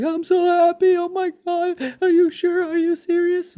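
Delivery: high, loud mock sobbing wail; hysterical happy bawling. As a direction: imitate a wife bawling with joy at top pitch. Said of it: "she's literally in Prompto, hysterical, bawling her eyes out".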